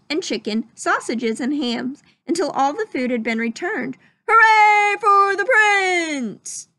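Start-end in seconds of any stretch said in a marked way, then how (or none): 4.3-6.7 loud, high, slow cheer; cheering crowd. Acted. Happy crowd cheers the prince loudly. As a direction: stretch out a big hooray. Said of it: "shouted the happy crowd".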